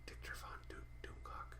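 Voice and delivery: unvoiced whisper of the names. Real, not acted. Avoiding naming a rival channel, whispering the names. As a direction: breathe the names like a secret.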